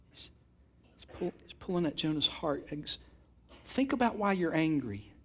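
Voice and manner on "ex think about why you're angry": normal talking voice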